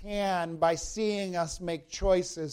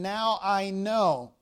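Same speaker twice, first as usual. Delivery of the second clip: "Now I know" slowed, with NOW leaned on; stress on NOW; slower than usual. Shown as a demonstration. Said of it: "notice this curious expression".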